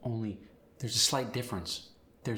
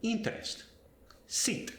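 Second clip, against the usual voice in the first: Interest with a Spanish ee, pitched up; Spanish accent; 11 semitones higher than usual. Shown as a demonstration. Teacher showing Spanish I vowel, Spanish accent. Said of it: "it sounds like I instead of I".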